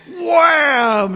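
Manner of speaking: loud, high shouted impact word; mock crash shout; shouted wham. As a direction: shout the hit like a sound effect. Boy headbutting, retold impact.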